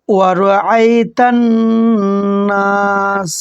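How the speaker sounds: slow chanted tilawat; Quran recitation. Shown as a demonstration. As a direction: recite each word slowly and melodically. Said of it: "এইভাবে তেলাওয়াত করবেন". (ধীর সুরেলা তিলাওয়াত)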